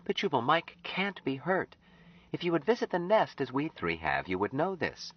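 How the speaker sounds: put-on high, earnest young woman's voice; Anne. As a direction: voice Anne, pleading gently with Jubal.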